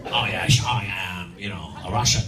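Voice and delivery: very low Scottish film-star voice; Sean Connery. Acted. Scottish actor playing a Russian, low Connery impression. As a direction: play a Russian in unchanged, deep Scots. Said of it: "And then he comes on".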